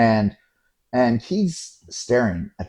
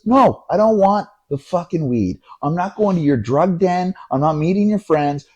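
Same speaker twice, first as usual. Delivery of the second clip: raised-pitch indignant retort; angry refusal; 7 semitones higher than usual. Retold or imitated. Friend refuses the cab driver's offer, angry. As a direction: imitate a friend laying down the law.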